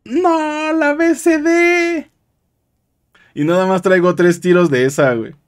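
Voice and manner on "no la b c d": high, slow dismayed wail; dismayed cry. Real, not acted. Realises he lost the weapon.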